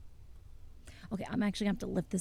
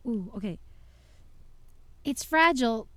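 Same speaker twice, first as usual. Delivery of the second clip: normal talking voice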